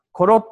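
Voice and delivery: コロッ cut short with a stop at the end; clipped small tsu. Shown as a demonstration. Teacher shows the conversational form, clipped short. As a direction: say コロッ with the small っ, stopping sharp. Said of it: "と小さいつでやります。".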